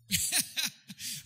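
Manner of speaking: a loud laugh breaking through; real laugh. Real, not acted. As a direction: burst of high laughter. Speaker laughs at own prayer.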